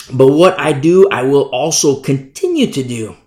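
loud, emphatic verse reading; forceful reading aloud, slowing at the end; scripture reading. Real, not acted. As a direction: proclaim the verse from the pulpit. Reads Bible verse aloud.

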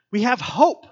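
preacher proclaiming hope loudly; emphatic declaration. Acted. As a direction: ring it out. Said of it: "repeats the line for emphasis".

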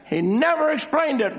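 high, insistent stress on "never"; emphasis on never. Real, not acted. Preacher repeating his point to listeners, strong emphasis on "never". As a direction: punch out "never" for the congregation. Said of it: "making a very special, uh, emphasis".

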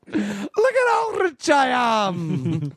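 high, very drawn-out mock-Scottish wail; exaggerated feverish cry, bad Scottish brogue; bad Scottish accent. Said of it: "sounded more like a drunk".

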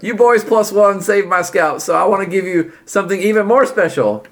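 grateful Rebus. Acted.

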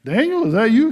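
the stunned king can't believe his ears; shocked king. Retold or imitated. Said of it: "I'm sure he was like".

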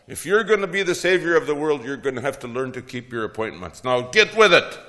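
high, loud and fast tirade; furious scolding. Acted. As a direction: chew him out for arriving late. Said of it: "She's furious".

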